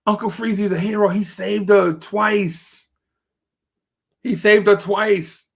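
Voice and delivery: mock crowd cheering; excited crowd praise. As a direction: hype him up as the hero. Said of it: "everybody's like".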